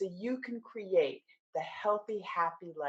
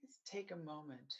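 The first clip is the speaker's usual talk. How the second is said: guided meditation; 2 semitones lower, 4 dB quieter than usual. Acted. Coach leading listeners through mindfulness of the body, guided meditation. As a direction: guide them gently through the body, unhurried, settling softer.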